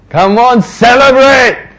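high, loud, cheering call; rally everyone to celebrate; urging joy. Acted. Father urging everyone to celebrate, high joyful call.

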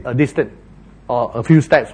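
normal talking voice

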